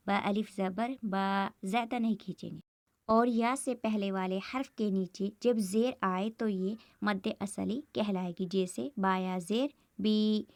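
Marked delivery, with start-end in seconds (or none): 0.1-1.5 slow, then hold the last बा for one alif's length; slow spelled-out recitation. Shown as a demonstration. Teacher spelling out बा for students, stretched slowly. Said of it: "एक second या दो second तक हम खींचकर पढ़ेंगे ऐसे". 9.0-10.5 slow, stretch बी for a second or two; slow spelled-out recitation. Shown as a demonstration. Teacher demonstrating बी to students, stretched slowly. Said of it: "एक second तक या दो सेकंड तक खींचकर पढ़ेंगे".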